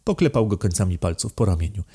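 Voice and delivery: usual speaking voice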